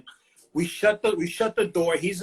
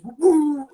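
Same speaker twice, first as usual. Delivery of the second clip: high, louder woo woo like a police siren; siren imitation; 10 semitones higher, 7 dB louder than usual. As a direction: mimic the siren wail.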